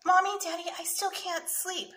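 put-on high, plaintive little-boy call; child crying out. A narrator's reading. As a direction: wail it mildly to Mom and Dad. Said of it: "Sammy cried".

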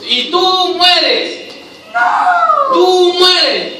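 TÚ drawn out and pitched high; emphatic stage direction. Shown as a demonstration. Director tells the actor playing Julio to die, drawn-out insistence. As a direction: stretch "tú mueres" slowly, high and insistent.

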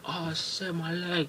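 high, yelping pain; pained cry. Retold or imitated. Neighbour shot in the leg, high pained yelp. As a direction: squeal it like you've just been shot.

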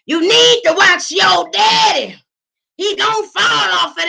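high, loud slow warning; shouting old man. Retold or imitated. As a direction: bellow the warning from Judgment Row.

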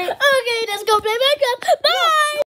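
high loud squealing laugh. Acted. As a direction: shriek it out playfully. Playful squealing exit.

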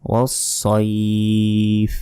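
ওয়াস-সাইফ as "was-soiiif", thick s; slow recitation holding the end of ওয়াস-সাইফ; rounded "soi", long-held; heavy sad. Shown as a demonstration. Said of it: "সইফ বলবেন".

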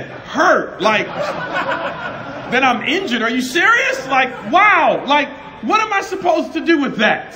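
high, loud, laughing disbelief at the friend's confession; half-laughing are-you-serious shock; incredulous outburst. Retold or imitated. Retelling his stunned reaction to a friend's prayer, laughing incredulity.

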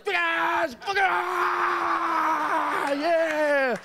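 high, hammed-up climax yell; mock orgasm cry. Comic acting out a drunken climax, high hammed-up cry. Acted climax during sex joke.

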